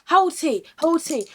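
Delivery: loud, high imitation of a pushy question; retold insistent question. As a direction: fire it across the table, loud and high. She leans across the table and goes.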